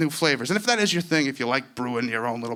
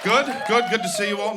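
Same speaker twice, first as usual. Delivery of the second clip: put-on British accent; 9 semitones higher, 3 dB louder than usual. Fake British accent opening bit.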